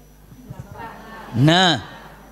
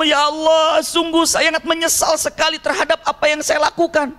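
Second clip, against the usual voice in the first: high, raised-voice lament to God; 10 semitones higher than usual. Acted. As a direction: cry out in remorse, pitched high throughout. (ratapan penyesalan bernada tinggi)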